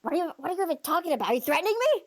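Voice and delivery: loud indignant exclamation. Acted. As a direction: bellow it so the whole bar hears. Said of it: "was that a loud exclamation".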